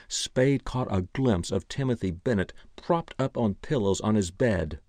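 plain reading voice

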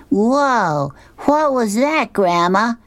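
put-on a kid jumping at a noise; little boy's voice. Nelson asking Grandma about the scream, high and startled. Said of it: "Nelson says to Opal".

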